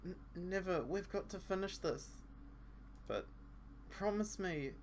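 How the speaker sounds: put-on a young woman pushing through, quietly firm; determined girl. Asa Senpai insisting to Asa Senpai's partner, soft and high.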